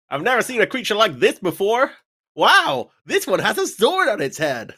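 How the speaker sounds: very high, wide-eyed wonder; amazed game character. Acted. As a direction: marvel at a strange creature, high-pitched. Reads an excited game character.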